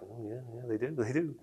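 very soft mock yes; hushed eager agreement. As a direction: nod along barely above a breath. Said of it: "You're like".

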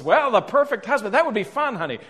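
high, lilting mock-sweet wife; imitated wife. Wife takes up husband's idea of profiling the perfect husband, imitated high and eager. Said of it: "I kinda knew I was in trouble".

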